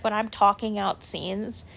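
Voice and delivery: normal talking voice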